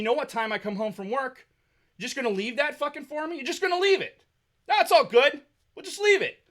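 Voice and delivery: high, sneering mock acceptance; sarcastic anger. Real, not acted. Scolding housemates who left the mess, high sarcastic sneer. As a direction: throw their excuse back at them, sarcastically.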